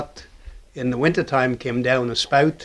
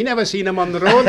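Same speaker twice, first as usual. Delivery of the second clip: laughing; 5 semitones higher, 4 dB louder, faster than usual. Retold or imitated. Retold quote, laughing at punchline.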